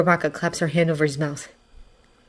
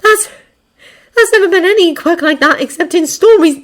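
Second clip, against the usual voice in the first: high, louder, faltering start; shaken; 11 semitones higher, 7 dB louder than usual. A narrator's reading. Yaoyorozu doubts that such a quirk exists, shaken. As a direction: stumble into alarmed disbelief. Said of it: "Yaoyorozu sounds shaken".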